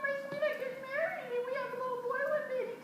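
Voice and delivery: very high, laughing half-sob; wailing silly girl. Acted. Girl tells her mother about the axe, high mock-wailing.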